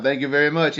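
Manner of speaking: ordinary talk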